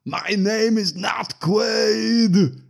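huge high, drawn-out movie-hero bellow; mock shout. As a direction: scream it slowly at full volume, imitating the hero. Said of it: "then he shouts".

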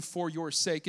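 usual speaking voice

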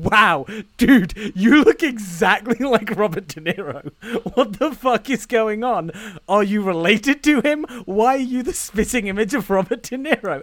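loud, amazed disbelief, laughing through it; mock astonishment. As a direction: gawk at the double, half laughing. Mock disbelief at the look-alike.

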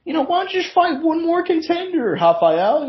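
high, put-on voice of the promotion brushing him off; lightly mock the bosses stalling him; mock matchmaker. Promotion telling the fighter to wait, in a high mocking voice. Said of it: "they're like".

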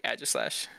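AEGISLASH starting with short A as in "cat"; own pronunciation. Shown as a demonstration. Confirming their own version, short-A "Aegislash". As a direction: say "Aegislash" as AJ-iss-lash, falling at the end. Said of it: "I say Aegislash".

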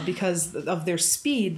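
ordinary talk

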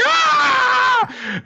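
put-on long, very high child's wail; hammed-up little-kid scream; imitated horrified shriek; high mock scream. Acts Anya screaming at death scene.

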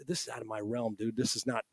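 low, hushed words to the agent beside him; nervous murmured aside. Retold or imitated. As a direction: keep it down so the room can't hear.

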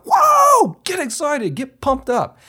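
big whooping shout, way up high; pumped-up yell. Shown as a demonstration. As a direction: hype him up, show him how to let loose. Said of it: "Just look at me, man".

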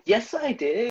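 high-pitched put-on protest; mock indignant retort. Daughter answering mom who doubted she believed it, mock indignation. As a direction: wail it back in mock hurt.

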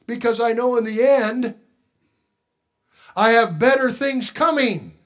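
loud, high preaching; rising pulpit cry. Real, not acted. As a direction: preach it out with fire. Preaching at full force.

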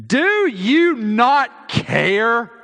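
slow, loud, high demand; indignant demand. Retold or imitated. Martha demanding the Lord care, slow loud shout. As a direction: drag out each word in protest.

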